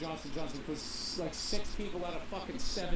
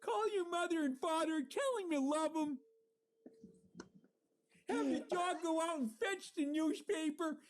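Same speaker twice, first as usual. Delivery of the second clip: Lou Holtz impression; 8 semitones higher than usual. Doing Lou Holtz for his co-host, high coach voice. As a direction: preach faith to the team like an old-school coach. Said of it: "Your Lou Holtz is one of my favorites".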